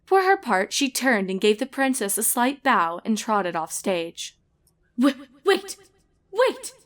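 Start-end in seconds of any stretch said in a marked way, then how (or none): 5.0-6.7 stammered, rising high plea; frantic call. Acted. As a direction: call after her in a panic. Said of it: "darted around frantically".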